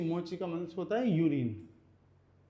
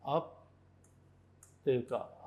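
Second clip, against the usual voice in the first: आप्तेका very slow and softer, aap, pause, teka; syllable by syllable; 5 semitones lower, 5 dB quieter, much slower than usual. Shown as a demonstration. Teacher shows the word for medical store, आप्तेका slow and split. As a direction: dictate आप्तेका quietly in two separated parts. Spelling out word while writing.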